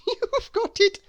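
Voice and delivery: giggling. A narrator's reading. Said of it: "she giggled".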